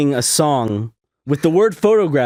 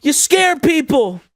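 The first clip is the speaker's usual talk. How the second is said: mock scolding; 12 semitones higher, slower than usual. Scolding Ming for her antics, high mock outrage. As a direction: scold her like a misbehaving pet.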